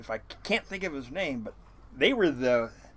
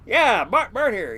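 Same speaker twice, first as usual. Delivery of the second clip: posing as Bart; 8 semitones higher, 8 dB louder, slower than usual. Retold or imitated. Pretends to be Bart on phone.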